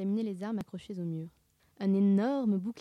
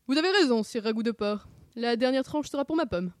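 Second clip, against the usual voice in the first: raised, theatrical knightly voice; gloating mock knight; 3 semitones higher, 5 dB louder than usual. Sammy claims the last slice, pompously gloating. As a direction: gloat grandly, oblivious to danger.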